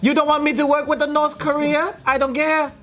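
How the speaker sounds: a lightly hammed Chinese shop owner who welcomes all; mock Chinese businessman accent. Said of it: "These are all quotes".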